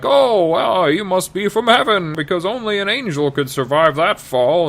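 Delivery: high, gushing mock wonder; a hammed-up amazed old man; awed Cain. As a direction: marvel at the angel breathlessly. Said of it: "Cain's like".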